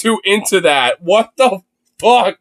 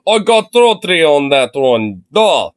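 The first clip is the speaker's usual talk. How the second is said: lower, hammed-up Russian bidder; mock Russian accent; 3 semitones lower than usual. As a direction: imitate a random Russian at an auction. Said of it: "Who's that random Russian motherfucker?".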